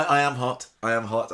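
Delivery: ordinary talk, nothing marked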